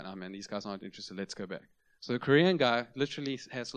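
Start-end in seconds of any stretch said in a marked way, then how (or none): 0.0-1.6 low, soft, quick mumble of defeat; discouraged. Retold or imitated. German evangelist to his partner after five doors, discouraged. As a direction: give up and suggest going home. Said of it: "German guy gets discouraged".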